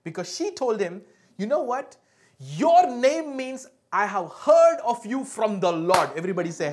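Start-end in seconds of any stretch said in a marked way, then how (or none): 1.4-6.1 raised, declaiming voice; loud, slow declaration of a name's meaning; emphatic mother. Acted. Mother telling Samuel what his name means, loud and emphatic. Acting mother naming her son.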